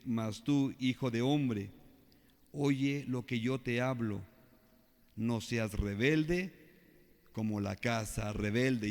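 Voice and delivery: no seas rebelde drawn out very slowly; slow emphatic reading. Acted. Preacher reads verse eight to the congregation, slow emphatic warning. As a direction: lean on every word, rebelde stretched.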